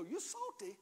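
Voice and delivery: high, sing-song teasing, climbing then easing; the pastor showing the church how to tease a neighbor, high and playful. Shown as a demonstration. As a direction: shake it at them and tease. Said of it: "go like this and go".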